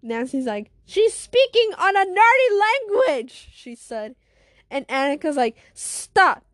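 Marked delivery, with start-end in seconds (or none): 0.8-3.5 high, loud objection; raised-pitch outburst; loud exclamation. Acted. Character mocks another's odd wording. 5.7-6.4 loud, higher-pitched command; forceful order to stop; sharp warning. Acted. Character warns before threatening punch.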